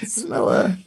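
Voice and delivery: high, hammed-up voice naming weed strains; mock sniffing cop. Cohost mocks the cop sniffing the car, high and hammed-up. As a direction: squeak it out like a cop who's a weed connoisseur.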